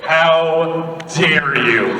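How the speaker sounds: loud, drawn-out mock indignation; mock outrage. Comedian playfully scolding a fan, slow mock outrage. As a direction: stretch each word in hammed-up offence.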